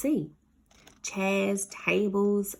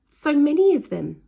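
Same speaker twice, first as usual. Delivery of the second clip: louder despairing line; dismayed cry; 7 semitones higher, 10 dB louder than usual. Acted. Ned overwhelmed by cats, louder. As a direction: lament it.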